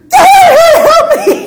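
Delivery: hammed-up desperate yelp; mock panic. Wife greeting her husband at the door with a mock panicked cry. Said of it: "that's how I was feeling".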